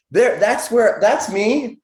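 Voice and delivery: like spotting himself at last, a mock eureka; excited discovery. Meditator pretending to find himself, excitedly.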